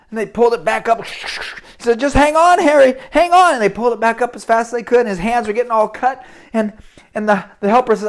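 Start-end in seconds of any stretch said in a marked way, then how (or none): 2.0-3.5 urgent shout, higher and louder; calling down. Acted. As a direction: yell encouragement down the pipe. Father shouts down to trapped son.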